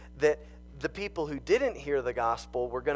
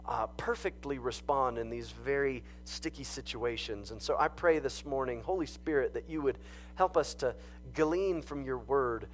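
softer, hushed prayer voice; 4 dB quieter than usual. Real, not acted. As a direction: pray quietly and sincerely. Leads congregation in prayer.

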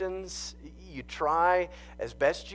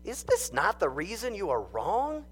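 louder, higher dramatic Bible reading; scripture reading; 5 semitones higher, 4 dB louder than usual. Acted. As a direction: proclaim the passage to the congregation. Reads scripture aloud.

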